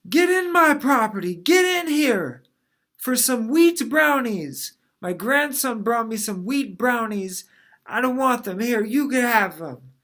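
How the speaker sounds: hammed-up grandma shouting at the kids to come get brownies; yelling grandma. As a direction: call the kids in like a batty grandma.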